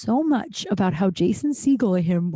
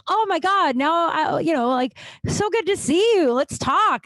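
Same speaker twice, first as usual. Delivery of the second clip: gushing excitement; 9 semitones higher than usual. Retold or imitated. Celebrity gushing at her.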